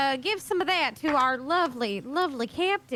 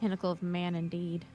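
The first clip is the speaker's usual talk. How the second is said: much lower, soft, dry; dry sarcastic aside; 11 semitones lower, 7 dB quieter than usual. Acted. Belle mocking the greasy official under her breath, low and dry.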